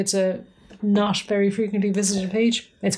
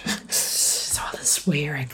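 slow, hushed mock-shocked whisper; 4 semitones lower, 4 dB quieter, much slower than usual. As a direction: whisper it like a scandalised aunt. Mock-scandalised aside about swearing.

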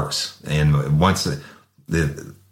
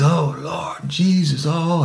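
high, slow drawn-out moaning; mock anguished wail; 13 semitones higher, slower than usual. Sprayed suspect crying out in pain on his knees, wailing. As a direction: wail to the Lord like you're in agony.

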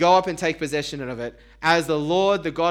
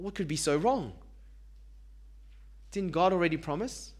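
ordinary talk

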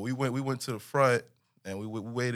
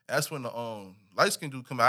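normal talking voice

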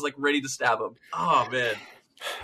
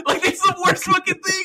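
normal talking voice

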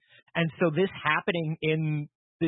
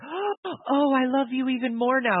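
mock-swooning, pitched higher; swooning love interest; 8 semitones higher, slower than usual. Mocking superhero movie romance, mock-swooning high voice. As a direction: gush like a smitten movie girlfriend.

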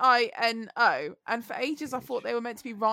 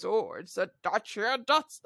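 imitating a deep-voiced advert man, lower; 4 semitones lower, 3 dB quieter than usual. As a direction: attempt a really deep announcer voice. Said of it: "it's got the really deep sounding voice".

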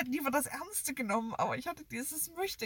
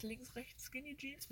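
very soft, puzzled murmur; muttering; 15 dB quieter, slower than usual. Real, not acted. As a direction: mutter it to yourself, thinking. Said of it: "Mir fällt nichts ein".

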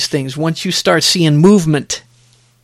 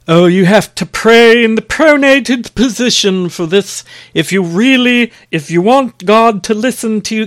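higher, put-on voice of a preachy legalist; mock legalistic scold; 4 semitones higher than usual. Preacher mocks Satan's legalistic whisper in a higher, preachy put-on voice. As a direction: sneer it out as a nitpicking rule-monger. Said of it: "that's Satan messing with you, trying to insert legalism".

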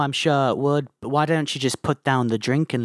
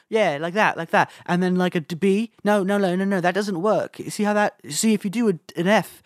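high, eager-to-please patter; chatty, agreeable bandmate impression, pitched up; 4 semitones higher than usual. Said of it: "[name] was always talking fast, like, you know, trying to be agreeable".

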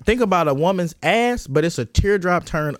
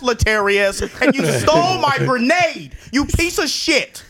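mock betrayed rant. Robbed kid confronting Latarius over the grenade, mock betrayal. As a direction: start measured, then climb into drawn-out mock fury.

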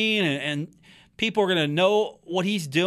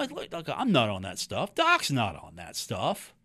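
lightly voiced coach, low and subdued; Doc; 8 semitones lower, 6 dB quieter than usual. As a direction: quote him in a lower, softer voice. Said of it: "And Doc has said".

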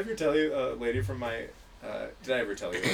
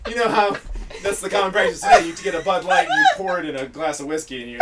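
ordinary talk, nothing marked

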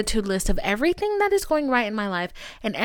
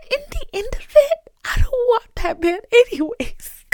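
high, whiny fake sob; tearful pretend whine; mock crying. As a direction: wail it like a hammed-up heartbreak. Mock crying over a man.

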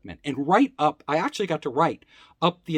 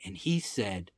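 ordinary talk

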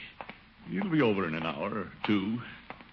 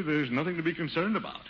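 higher, full-voiced assurance to others; confident reassurance; 5 semitones higher than usual. Reassures his niece aloud.

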